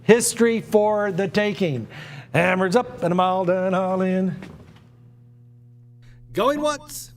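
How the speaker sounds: auctioneer calling the bids. Acted. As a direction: cry out going once, going twice. S0 runs the auction calls.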